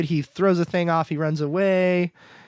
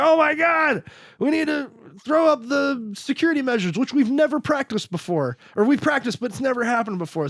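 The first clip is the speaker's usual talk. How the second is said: higher, hurried mock panic; 9 semitones higher than usual. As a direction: play the flustered staff losing it. Panicked base staff quoted.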